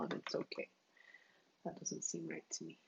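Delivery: soft puzzled whisper; whispered aside. Real, not acted. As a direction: let it drop to a whisper, confused.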